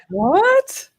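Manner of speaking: high rising surprised "what?"; incredulous, pitch leaping up; puzzled disbelief with a laugh. Real, not acted. Reacting to a puzzling remark.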